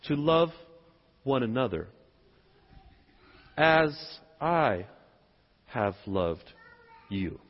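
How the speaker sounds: low, halting phrases with long pauses; slow, weighty quote. Real, not acted.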